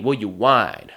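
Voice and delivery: ordinary talk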